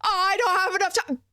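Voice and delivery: sarcastic mock whine. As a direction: mimic his complaint with scorn. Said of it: "I am assuming".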